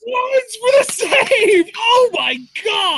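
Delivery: high, stunned yell; shocked shout. Real, not acted. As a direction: cry out in disbelief. Shock at surprise save.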